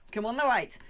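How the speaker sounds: ordinary delivery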